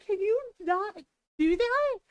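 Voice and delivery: high, pleading mock complaint; playful exasperation. Teasing her sweet partner, high playful whine. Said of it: "he started laughing 'cause it's like".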